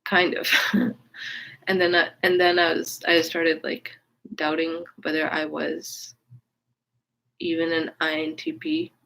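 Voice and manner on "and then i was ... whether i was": normal talking voice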